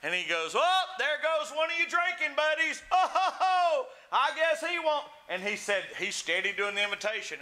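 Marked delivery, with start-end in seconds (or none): 0.6-5.0 high, laughing mockery of a drinking buddy; jeering. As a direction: imitate rowdy drunks poking fun. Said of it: "started joking and making fun".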